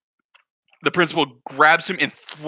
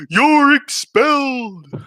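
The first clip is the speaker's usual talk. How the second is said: loud, slow, higher bellow; yelling; 7 semitones higher, 5 dB louder, much slower than usual. Retold or imitated. Principal expelling the kid, yelling. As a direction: roar the verdict.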